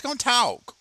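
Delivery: silly high voice; squeaky high. Pretend. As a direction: chirp it way up. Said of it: "repeated phrase".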